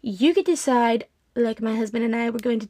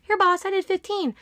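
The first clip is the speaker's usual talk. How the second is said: mock eager, much higher; eager employee; 8 semitones higher than usual. Pretend worker showing off to the boss, eager and high. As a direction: play the keen overachiever proudly. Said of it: "go find somebody else's work and be like".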